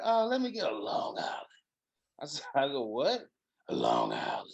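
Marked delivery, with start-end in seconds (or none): 0.0-1.5 sudden deep voice. Retold or imitated. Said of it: "why your voice get deep like that". 2.9-3.2 surprised. Retold or imitated. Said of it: "why your voice get deep like that". 3.7-4.5 mock the woman's voice suddenly going deep. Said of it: "why your voice get deep like that".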